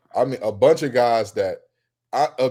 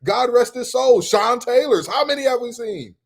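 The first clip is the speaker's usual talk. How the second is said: high, loud, worked-up rant; heated rant; 10 semitones higher, 4 dB louder than usual. Real, not acted. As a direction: keep it high and insistent. Heated rant about greats.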